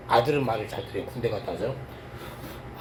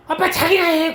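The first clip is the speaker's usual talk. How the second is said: daughter's snap; 11 semitones higher, 5 dB louder than usual. Retold or imitated. Quoting daughter's rude retort.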